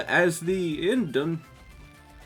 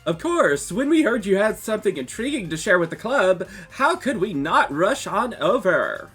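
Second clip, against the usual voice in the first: high, bright rush of excitement; overeager; 8 semitones higher, 4 dB louder than usual. Acted. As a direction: gush with eager delight. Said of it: "sound a little overeager".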